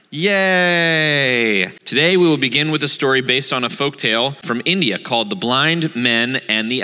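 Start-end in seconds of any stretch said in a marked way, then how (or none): 0.1-1.7 long drawn-out yay; cheer. Pretend. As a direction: cheer it in one long falling note. Cheers for story time.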